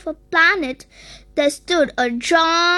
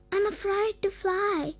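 small, soft voice, a touch sad; mildly sad; 3 semitones higher, 9 dB quieter than usual. A narrator's reading. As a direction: quietly confess it with understated sadness. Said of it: "Spark said sadly".

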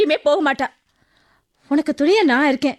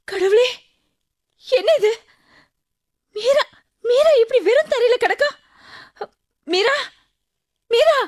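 put-on higher, frantic calls over the girl on the floor; alarmed calling; 6 semitones higher than usual. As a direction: panic and shake her awake with your voice. Neighbour finds Meera on floor. (பதறிப்போன அழைப்பு)

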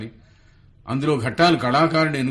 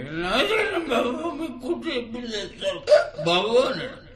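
pestering caller; 11 semitones higher than usual. Acted. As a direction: whine it out in a high, stretched mimic voice. Same caller pesters shop owner again. (ఎత్తైన గొంతుతో సాగదీసిన బతిమాలే పిలుపు)

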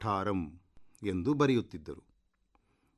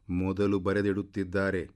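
usual speaking voice

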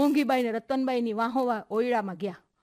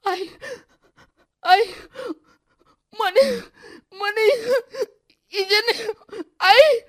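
high, loud wail in single gasped words; broken, hiccuping sobs; 12 semitones higher, 10 dB louder, slower than usual. A narrator's reading. Sobbing daughter trying to tell her mother, voice broken. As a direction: sob between words, unable to get a sentence out. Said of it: "તૂટક તૂટક અવાજે બોલતી હતી".

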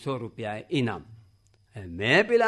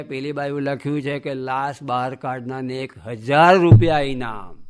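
louder and louder to the last word; punchline shout; 8 dB louder than usual. Retold or imitated. Punchline, loud build.

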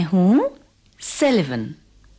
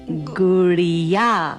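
Gudiya as one slow, stretched word; joined, drawn out; 9 semitones higher, 4 dB louder, much slower than usual. Acted. Teacher sums up the lesson, Gudiya drawn out whole. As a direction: stretch each vowel of Gudiya without breaking it.

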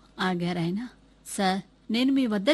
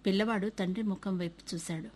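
ordinary delivery, nothing marked

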